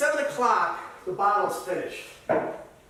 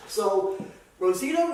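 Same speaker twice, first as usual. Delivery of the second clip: usual speaking voice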